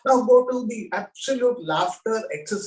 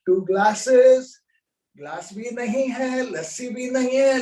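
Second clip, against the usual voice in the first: ordinary delivery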